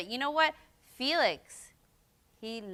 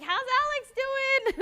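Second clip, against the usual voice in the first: friendly politician quote; 6 dB louder, slower than usual. Retold or imitated. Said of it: "He's like".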